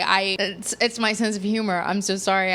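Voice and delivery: ordinary talk, nothing marked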